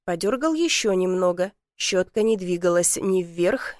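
ordinary delivery, nothing marked

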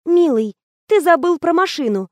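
high, cooing reminder; tender. A narrator's reading. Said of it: "нежно заговорила Лена".

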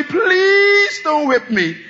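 slow, high, wailing plea for mercy; slow crying shout of a beaten man. Pretend. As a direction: beg through tears not to be whipped. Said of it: "So you can cry all you want".

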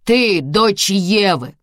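loud slow accusation; contemptuous shout. Acted. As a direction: hurl it at her. Husband spits accusation.

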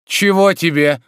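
loud, high, threatening demand; menacing. A narrator's reading. Master suddenly opening his eyes at servant, menacing. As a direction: snap it out at the intruder. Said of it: "грозно спросил Обломов".

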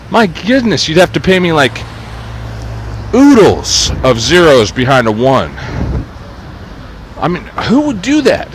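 high, fluting mock astonishment. Real, not acted. Joking about being paid to adapt the book, mock shocked. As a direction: sing it up high, feigning shock.

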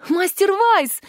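high-pitched cry of disbelief; incredulous cry. A narrator's reading. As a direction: call out high, hardly believing your ears. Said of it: "закричала я, не веря своим ушам". (изумлённый крик)

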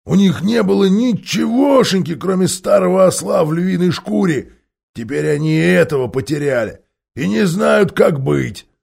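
loud scornful dwarf; jeering dwarf. Acted. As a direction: gloat to the crowd, loud. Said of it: "выкрикнул он".

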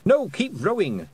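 high, drawn-out command; urgent order. A narrator's reading. Girl urging pictsies on at the oars, high urgent order.

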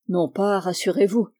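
ordinary delivery, nothing marked

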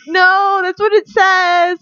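loud, high protest, drawn out at the end; bickering. Retold or imitated. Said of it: "bickering with the cabbie".

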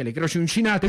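ordinary talk, nothing marked

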